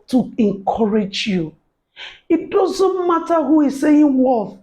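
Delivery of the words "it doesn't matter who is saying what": rousing call to take heart; emphatic shout. Real, not acted. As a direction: proclaim it to the viewers. Encouraging audience, rising voice.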